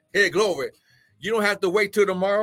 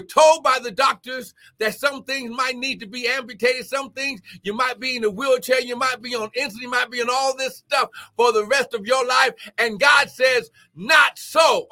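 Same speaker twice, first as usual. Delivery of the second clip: higher pitch, building to a shout; building preaching fervour; 4 semitones higher than usual. Real, not acted. Preacher rejecting the doctors' warnings, building fervour. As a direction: preach it up to a forceful shouted finish.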